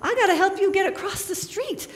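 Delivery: delighted to meet a creature in the road; greeting a snapping turtle on the road, excited and laughing. Retold or imitated.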